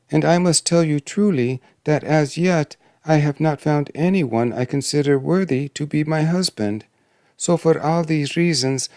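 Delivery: put-on high-pitched young royal voice; worried princess. Princess confides in the dwarf, worried. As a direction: lift the voice into an earnest princess.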